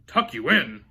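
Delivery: loud outraged bellow. A narrator's reading. Braidbeard answers the sleepy boy, loud bellow. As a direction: roar it in disbelief. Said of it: "he bellowed".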